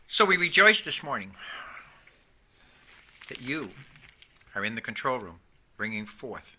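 low, soft prayer with long pauses; opening prayer. Real, not acted. Pastor asking heads to bow, hushed low prayer. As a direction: pray quietly, phrase by phrase.

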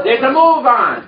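calling out the line for echo; preacher's call line; congregation repeat-after-me. Shown as a demonstration. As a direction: lead the response loudly.

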